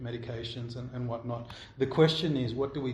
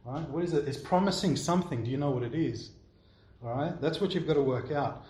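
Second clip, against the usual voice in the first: ordinary talk, nothing marked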